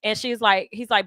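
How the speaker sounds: normal talking voice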